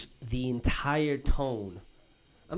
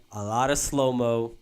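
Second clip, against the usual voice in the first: ordinary delivery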